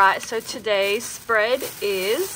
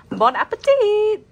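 cheery bon appetit; 12 semitones higher, 8 dB louder than usual. Real, not acted. Cheery meal sign-off.

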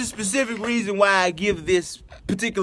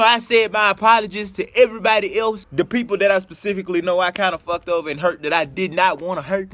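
ordinary talk

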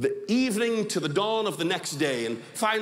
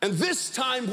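ordinary talk

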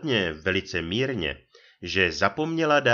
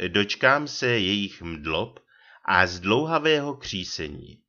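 ordinary delivery

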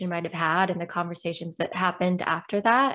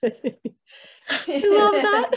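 real burst of laughter, much higher and louder; laughing; 5 dB louder, slower than usual. Real, not acted. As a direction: squeal with delight through the words. Host laughs at the funny story.

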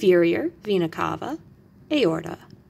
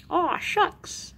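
cute character aside; 10 semitones higher, 4 dB quieter than usual. Acted. Cartoon aside in science lesson.

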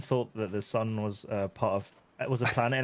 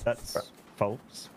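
unconvincing denial; 3 semitones lower, 5 dB quieter than usual. Real, not acted. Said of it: "even you didn't sound convinced".

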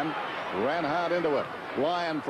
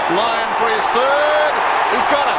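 excited goal call; 8 semitones higher, 12 dB louder than usual. Real, not acted. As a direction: lift it big as the kick goes through. Goal call in live commentary.

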